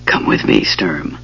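breathy unvoiced whisper. A narrator's reading. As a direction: whisper it close to him. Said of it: "Goldmoon whispered".

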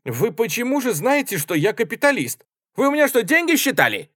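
very high, loud outburst; boiling indignation. A narrator's reading. Kapelbaum boils over at being called capitalist, indignant. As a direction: boil over in offended protest. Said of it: "Капельбаун весь вскипел".

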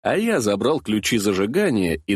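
ordinary delivery

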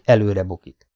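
plain reading voice

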